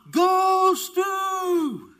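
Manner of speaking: very high, loud, sustained holler; mom's cheering yell. Retold or imitated. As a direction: imitate a sports mom yelling to her son. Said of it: "she kept on".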